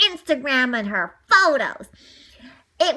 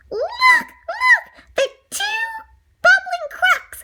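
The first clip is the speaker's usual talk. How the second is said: high, loud excited puppy voice; a small pup gasping at what he sees; 4 dB louder than usual. Acted. Puppy spotting the stolen pups, high and excited.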